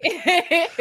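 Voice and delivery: a burst of high, loud laughter; real high laugh. Real, not acted. Friends joking about director.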